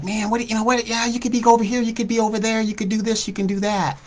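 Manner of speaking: high-pitched mimicry of people advising; well-meaning people. People tell him he could work elsewhere, high-pitched imitation. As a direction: run off their suggestions in a high imitated voice. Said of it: "talking to the people and they're like".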